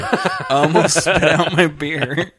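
slow, stretched out through laughter; wheezing it out while cracking up; laughing. Real, not acted. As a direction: repeat it helplessly laughing.

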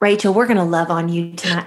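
put-on Southern accent. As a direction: lay the Southern accent on thick. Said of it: "with the Southern accent".

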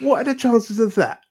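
very high, loud put-on voice; mock catchphrase. Comedian breaks off his story for a website plug, mock catchphrase. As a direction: pitch it way up like a jokey plug.

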